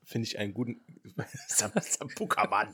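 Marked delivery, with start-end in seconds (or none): 1.2-2.7 breathy laughing whisper. Real, not acted. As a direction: laugh the name out. Laughs at nickname.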